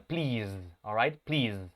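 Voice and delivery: Please drawn out slowly, final z buzzed; buzzing final z. Shown as a demonstration. Teacher repeats Please slowly, buzzing the z. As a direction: say Please with a long voiced z. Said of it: "el sonido de la vibración al final".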